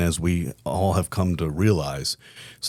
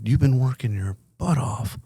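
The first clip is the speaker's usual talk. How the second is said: higher-pitched retold protest from a wife; imitating a disbelieving spouse, pitched up; dismayed protest; 5 semitones higher, slower than usual. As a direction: act out an incredulous wife, voice jumping high on the last words. Acting out wife's dismayed protest.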